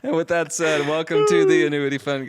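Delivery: show intro. Acted. Opening the episode for listeners, loud announcer welcome. Podcast intro after joking.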